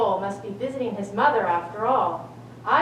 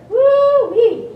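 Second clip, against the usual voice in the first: a high, loud teasing whoop; big goat whooping loudly at skinny little brother; excited whoop; 14 semitones higher, 10 dB louder than usual. Acted. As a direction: whoop it out, delighted to mock him.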